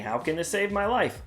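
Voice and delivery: ordinary talk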